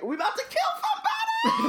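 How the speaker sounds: high, squealed mock shout; gleeful over-the-top villain squeal; hammed-up Voldy. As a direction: shriek it in a cartoonish high voice. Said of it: "Horcrux, Voldy, is like".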